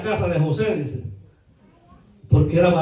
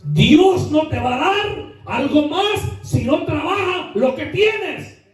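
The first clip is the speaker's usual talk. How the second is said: slow, high, emphatic preaching; 8 semitones higher, slower than usual. Acted. Preacher urging the congregation to work, in full preaching voice. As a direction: stretch it out, raising the voice to drive it home.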